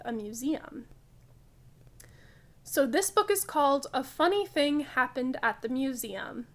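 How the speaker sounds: usual speaking voice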